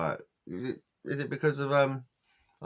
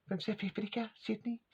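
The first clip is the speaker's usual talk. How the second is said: put-on high, soft South African accent on his name; mock South African voice, pitched up and quieter; 10 semitones higher, 9 dB quieter than usual. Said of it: "I can't keep doing his accent".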